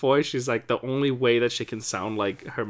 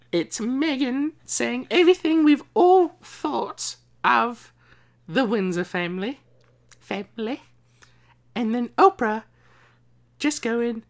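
put-on posh British accent; 10 semitones higher, 3 dB louder than usual. Host keeps the posh accent while summing up the interview. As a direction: keep the royal accent going. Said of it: "stop using that accent".

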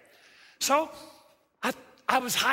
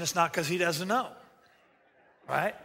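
ordinary delivery

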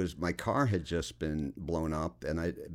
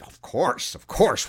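louder, higher mock reassurance; emphatic assurance; 8 semitones higher, 9 dB louder, slower than usual. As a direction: brush off the worry with loud certainty. Retold producers' over-emphatic false assurance.

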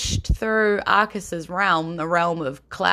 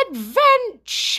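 high, slow, drawn-out cheer; gnome catchphrase; 5 dB louder, much slower than usual. Retold or imitated. Recalling the gnome's tagline to listeners, high drawn-out cheer. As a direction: sing out the one word like a gleeful little gnome. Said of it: "he would always reply with".

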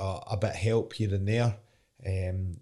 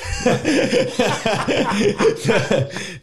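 loud high real laugh; high laughter; 13 semitones higher, 8 dB louder than usual. Real, not acted. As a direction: burst out laughing at yourself. Laughing at own impression.

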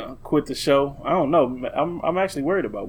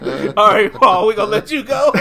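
laughing through it; 5 semitones higher, 6 dB louder than usual. Retold or imitated. Ending a prank call, laughing.

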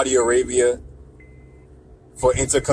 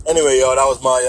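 normal talking voice

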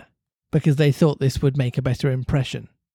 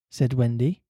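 usual speaking voice

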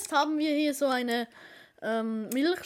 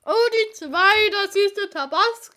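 excited; 5 semitones higher, 7 dB louder than usual. Real, not acted. Drew the Tabasco chocolate.

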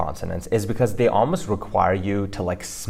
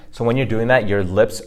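ordinary talk

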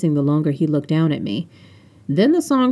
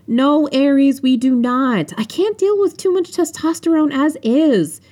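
high-pitched, exasperated refusal; whiny, high objection to a friend; exasperated protest; 9 semitones higher than usual. A narrator's reading. Phoebe protests to Aries in story.

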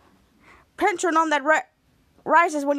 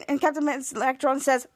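ordinary delivery

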